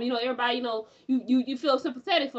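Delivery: usual speaking voice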